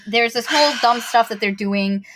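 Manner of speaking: ordinary talk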